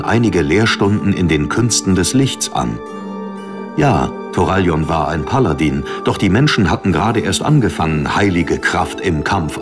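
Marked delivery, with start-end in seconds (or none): none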